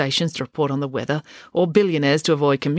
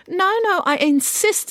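put-on high, syrupy old lady voice; a theatrical, patronising dame; gushing grand dame. Dame Bronwyn insists.

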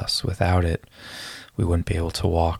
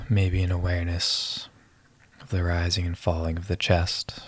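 calm breath-counting guide voice; guided meditation. Acted.

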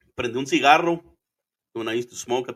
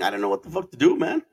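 ordinary delivery

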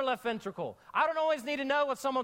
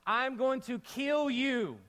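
slow menacing threat; 2 semitones higher, slower than usual. Retold or imitated. Preacher voicing Jezebel's threat slowly. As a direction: stretch each word like a cold threat. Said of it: "That's how she said it too".

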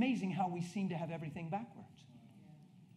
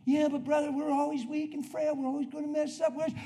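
lightly mimicked complaint, pitched up; mock defeated believer; 9 semitones higher, 4 dB louder than usual. Church member objecting to the preacher, mock complaint. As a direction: imitate a believer making weak excuses.

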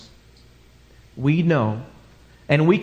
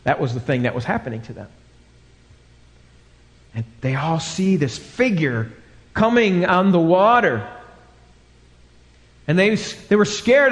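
ordinary talk

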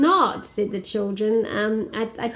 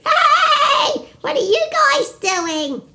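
high little lamb voice; chirpy curious lamb; 13 semitones higher, slower than usual. Acted. The little lamb asks the children what they're doing.

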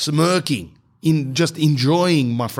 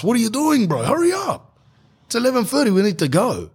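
high-pitched imitation of an impatient relative; mock nagging, pitched way up; impatient brother-in-law; 9 semitones higher than usual. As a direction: hurry him along in a much higher voice. Impatient brother-in-law hurrying him up.

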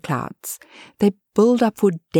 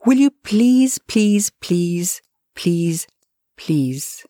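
pleading; much slower than usual. Acted. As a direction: beg insistently, fading as you repeat it. Sighs, then begs with repeated please.

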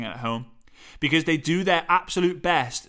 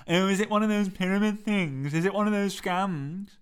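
daft, put-on questioning voice, pitched up; silly character voice; 3 semitones higher than usual. Shown as a demonstration. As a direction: ask it like a silly sceptic. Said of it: "someone who sounds a little bit silly".

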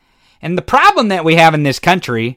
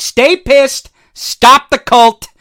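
rallying call; 5 semitones higher, 6 dB louder, slower than usual. Real, not acted. Rallying slogans at listeners.

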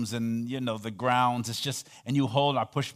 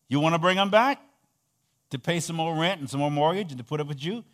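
ordinary talk, nothing marked

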